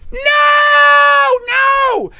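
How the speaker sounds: long, very high falsetto scream; horrified scream. Acted. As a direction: scream it in hammed-up dread. [name] dreads the spotlight.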